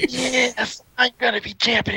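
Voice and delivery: high, strained voice of crazed triumph; gloating rival. Retold or imitated. Conrad sure of the title, frenzied gloating. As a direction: crow it like a maniac sure of winning.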